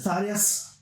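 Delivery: high, loud mock Irish accent calling a name; Irish cop hailing a familiar face, high and loud. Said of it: "You know, in the Irish accent".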